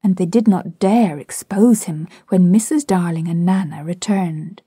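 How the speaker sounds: ordinary delivery, nothing marked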